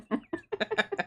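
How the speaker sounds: a high, airy burst of laughter. Real, not acted. Laughing high at a chat message after cheering.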